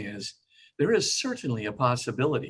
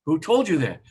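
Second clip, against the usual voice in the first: higher, louder, stunned question; shocked mother; 5 semitones higher, 7 dB louder than usual. Retold or imitated. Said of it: "And she goes".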